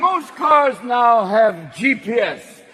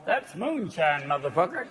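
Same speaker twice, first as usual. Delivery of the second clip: Southern drawl; 5 semitones lower, 9 dB quieter than usual. Retold or imitated. Southern folks about ethanol, low and proud. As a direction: growl it like a proud moonshiner. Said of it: "people in the South are going".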